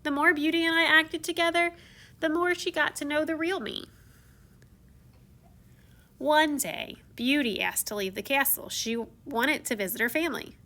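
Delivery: usual speaking voice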